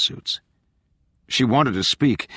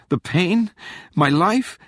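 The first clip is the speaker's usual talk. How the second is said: higher, fearful questions; anxious questioning; 10 semitones higher, slower than usual. A narrator's reading. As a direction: wonder in dread, pitch lifting. Frightened character wonders what ends.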